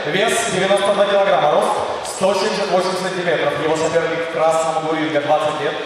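normal talking voice

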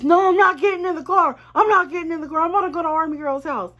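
put-on high, loud stubborn drunk refusal; drunk friend refusing. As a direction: play the drunk friend digging his heels in. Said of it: "he just".

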